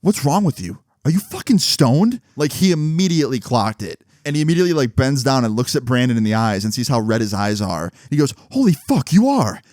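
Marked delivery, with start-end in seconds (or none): 0.0-2.2 acted accusing dad. Acted suspicious dad confronting son. 8.5-9.6 high, exclaimed disbelief; acted shocked dad. Acted dad discovering son is stoned.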